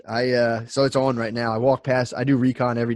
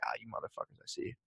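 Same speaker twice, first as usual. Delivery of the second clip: whispered discovery; 21 dB quieter, faster than usual. Retold or imitated. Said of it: "I was like".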